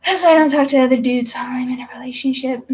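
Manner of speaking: quick whispered aside; fast whisper. Real, not acted.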